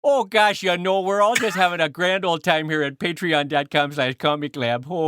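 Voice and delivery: folksy put-on Midwestern accent. Shown as a demonstration. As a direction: chat it out like a friendly caricature Midwesterner.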